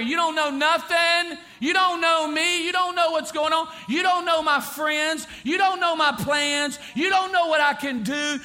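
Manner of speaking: loud, high, defiant rebel shouting at his father; demanding son. Retold or imitated. Son yelling at his father while leaving home, loud and defiant. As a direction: hurl it at the father like a spoiled son. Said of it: "he is demanding".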